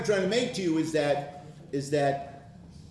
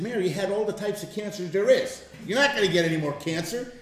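loud, high, half-laughing retort; mock exasperated scolding; 6 semitones higher, 5 dB louder than usual. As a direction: scold a loved one out of needless worry, half amused. Retold pep talk scolding his wife.

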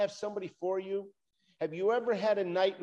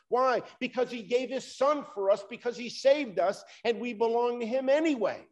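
higher, louder preaching, slowing on the cross; fervent preaching; 5 semitones higher, 4 dB louder than usual. Acted. Preacher telling the congregation God is for them, fervent and emphatic. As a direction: proclaim it to the whole church. Preacher builds to full force.

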